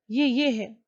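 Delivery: ordinary delivery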